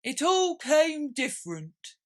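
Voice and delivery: high slow musing creature voice; slow loud pondering turtle; thoughtful Mock Turtle. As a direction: linger over it, thinking. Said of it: "repeated thoughtfully".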